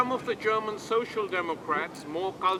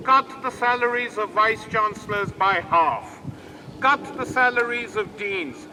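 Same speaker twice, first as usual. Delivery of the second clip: loud, slightly raised, emphatic oratory; rousing rally speech; 4 semitones higher, 7 dB louder than usual. Real, not acted. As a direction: fire up the crowd with forceful demands.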